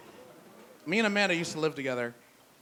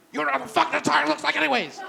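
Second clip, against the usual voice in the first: much higher, louder, fast yell; screaming; 11 semitones higher, 6 dB louder, faster than usual. Retold or imitated. Sleepless roommate screaming at Amanda over the spare tire, loud and fast. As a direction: scream it out, patience gone. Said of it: "And so I screamed at her".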